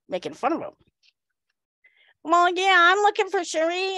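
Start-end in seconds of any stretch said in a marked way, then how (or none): 2.2-4.0 high, loud mimicked voice; high-voiced big man. Muscleman explains whom he wants, high mimicked voice. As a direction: imitate the muscleman's incongruously high voice. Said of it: "Is that really his voice?".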